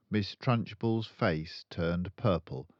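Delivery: ordinary delivery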